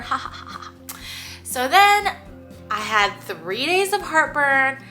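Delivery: ordinary delivery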